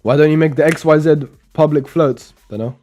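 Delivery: normal talking voice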